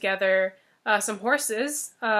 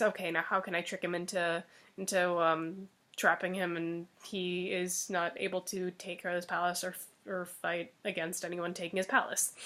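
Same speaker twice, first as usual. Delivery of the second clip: softer, lower private plotting; scheming to herself; 5 semitones lower, 8 dB quieter than usual. Acted. Fox plotting how to trap the ogre, quiet. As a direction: think the trick through under your breath.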